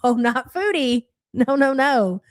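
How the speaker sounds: ordinary talk, nothing marked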